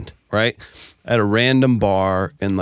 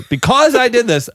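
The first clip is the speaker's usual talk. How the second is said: mock gesture line; 13 semitones higher, 4 dB louder than usual. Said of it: "throws that up there".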